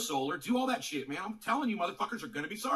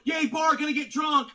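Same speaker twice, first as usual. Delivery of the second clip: higher, louder sarcastic yay; mock cheer; 7 semitones higher, 8 dB louder than usual. Ranting streamer mocks sports fans with a loud fake cheer. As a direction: ham up a dumb fan cheering.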